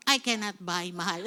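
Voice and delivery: low aside breaking into a laugh; joking aside. Acted. Preacher joking about an unaffordable house, amused. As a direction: drop the pitch and crack up at the end.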